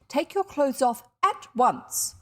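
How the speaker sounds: loud, high, slow command; stern order. A narrator's reading. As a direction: snap out a slow, forceful order. Aunt orders reluctant child.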